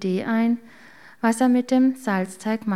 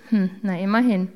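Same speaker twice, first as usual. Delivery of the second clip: inner chuckle. A narrator's reading. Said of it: "lachte in sich hinein und dachte bei sich".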